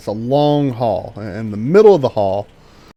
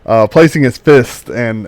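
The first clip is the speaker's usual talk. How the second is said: ordinary talk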